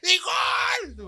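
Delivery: soaring, shouted goal call; goal commentary. Retold or imitated. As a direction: yell it like a Colombian football announcer. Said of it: "como narrador de fútbol aquí colombiano".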